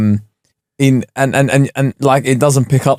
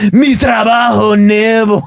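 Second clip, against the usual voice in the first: put-on higher, slow, passionate Mexican Spanish accent; mock Mexican Spanish accent; 7 semitones higher, slower than usual. Laughing at his own Duolingo Spanish, mock Mexican accent. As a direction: sell it with hammed-up Mexican Spanish flair. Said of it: "Why was I Mexican?".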